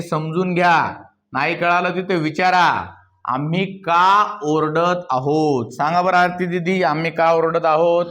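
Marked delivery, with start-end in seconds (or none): 3.2-5.7 half speed, lengthened words; slow dictation. Shown as a demonstration. Teacher giving the class a sentence, slow dictation. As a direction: dictate it slowly for translation.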